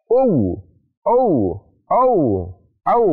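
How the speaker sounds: "u, au" opened into a wrong vowel glide; wrong reading demo. Shown as a demonstration. Teacher showing students the wrong "au" glide. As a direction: show the mistake "au" with the lips opening. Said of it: "maka tidak boleh dibaca".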